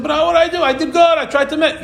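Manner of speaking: put-on high, whiny defense of a child; child's protest. A child defending himself to a parent, high and whiny. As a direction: protest your innocence like a hurt kid.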